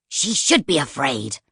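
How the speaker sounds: a cold snake of a book insisting; stern Poisons. Acted. Poisons arguing with Lady Feroza, stern and low. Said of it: "said Poisons".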